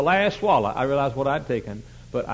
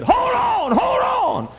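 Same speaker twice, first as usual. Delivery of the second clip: high, loud shout of a church lady at the altar; 6 dB louder, much slower than usual. Retold or imitated. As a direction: yell it at the sinner, much higher. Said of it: "another lady came by and said".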